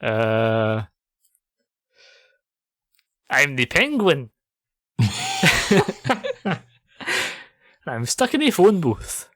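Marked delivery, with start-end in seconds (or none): 3.3-9.3 Colin Farrell. Retold or imitated. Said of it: "Colin Farrell".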